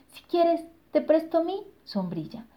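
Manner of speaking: high, sweet chick voice; little chick voice. Acted. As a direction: offer it kindly, up high. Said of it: "dijo el pollito". (voz aguda de pollito)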